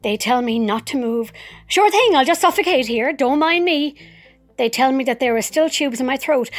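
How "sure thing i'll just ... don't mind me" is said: higher, louder, dripping with sarcasm; mock-cheerful retort to the nurses; sarcastic. Patient answering the staff in her head: biting sarcasm.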